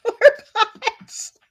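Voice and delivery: high, laughing poor bots; laughing pity. Real, not acted. Laughing at the bots in chat, high laughing pity. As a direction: giggle it out with mock sympathy.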